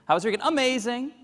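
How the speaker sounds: high, bright over-the-top amazing; mock cheery American. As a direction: gush like a chirpy American. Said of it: "when U S Americans go".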